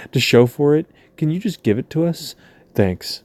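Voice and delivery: put-on cheeky favor-asking; mock offer. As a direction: ask with fake sweetness, then drop for the thanks. Mock offer continues after a laugh.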